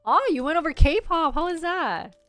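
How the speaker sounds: ordinary delivery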